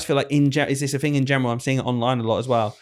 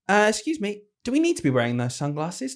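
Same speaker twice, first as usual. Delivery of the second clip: lightly voiced head teacher, higher opening; imitating head teacher; 7 semitones higher than usual. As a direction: politely pull a pupil up, mimicking authority. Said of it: "the head teacher go".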